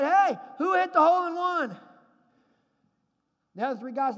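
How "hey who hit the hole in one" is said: loud, higher shout across the course; calling out from the cart. Retold or imitated. Sprinkler worker yelling to the golfers from his cart. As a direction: holler the question from afar. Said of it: "He said".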